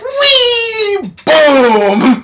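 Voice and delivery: very high squealing whee, then a loud crash boom; playful sound effects of a wild ride; gleeful whee then boom. Retold or imitated. Sliding off the snow ramp.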